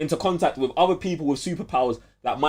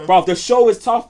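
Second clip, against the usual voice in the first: higher and louder hype; excited; 8 semitones higher, 8 dB louder than usual. Real, not acted. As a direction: pump it up with excitement. Hyping up a TV show.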